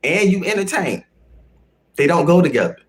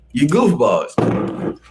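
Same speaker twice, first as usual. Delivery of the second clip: slow drawn-out scolding; 2 semitones lower, much slower than usual. Pretend. Streamer teasing chat, slow drawn-out scold. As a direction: stretch goofballs out slowly and lazily. Streamer tries the line two ways.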